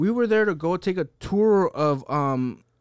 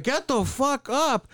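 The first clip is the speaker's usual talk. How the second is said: mock command; 7 semitones higher, slower than usual. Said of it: "Craig Robinson goes like".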